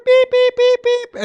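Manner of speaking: high, loud, level-pitched beeps; high censor beeps. Retold or imitated. Speaker mimics viral toddler's censored foul language, high loud beeping. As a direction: imitate a bleep tone over a toddler's swearing. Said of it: "Little, little two-year-old saying".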